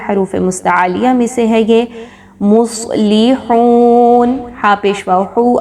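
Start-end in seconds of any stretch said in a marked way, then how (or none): 2.4-4.4 مصلحون very slow with a thick, heavy ص; مصلحون recited slowly, ص thick; heavy saad. Shown as a demonstration. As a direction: drag out مصلحون, the ص full and heavy. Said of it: "ہا کی حرکت کو بہت نرمی کے ساتھ".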